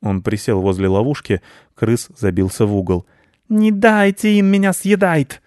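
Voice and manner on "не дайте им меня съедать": high, loud, slow plea; desperate rodent wail; imploring rat. A narrator's reading. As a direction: beg for your life as a cornered rat. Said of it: "взмолился он".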